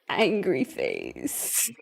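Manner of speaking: very low, slow, soft angry voice; hammed-up low grumpy reading; mock angry. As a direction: drag it out in a low mock menace. Reading out poll's angry face.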